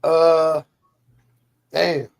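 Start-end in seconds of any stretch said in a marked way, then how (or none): none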